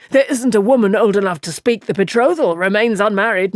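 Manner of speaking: high, breathless gossiping innkeeper voice; chattering innkeeper. A narrator's reading. Innkeeper gushing to Moraine about the weddings, high and excited. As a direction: rattle it off like a tired, excited village innkeeper.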